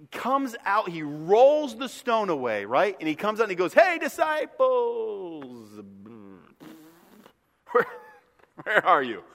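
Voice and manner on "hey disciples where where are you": slow, drawn-out call for the disciples; Jesus calling for his missing disciples, slow and drawn out. Retold or imitated. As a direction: stretch it out, searching for them. Risen Jesus looking for disciples.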